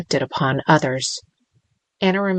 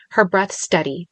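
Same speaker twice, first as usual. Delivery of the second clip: usual speaking voice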